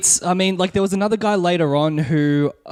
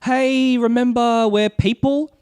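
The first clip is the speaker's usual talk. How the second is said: higher-pitched company spokesman imitation; mock PR plea; 7 semitones higher than usual. PR team addressing players at a presentation, mock pleading. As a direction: plead sweetly on the company's behalf, in parody. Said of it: "it was very much like".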